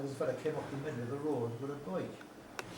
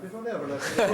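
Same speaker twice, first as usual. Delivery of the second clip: laughing; 4 semitones higher, 8 dB louder than usual. Real, not acted. Laughing while telling story.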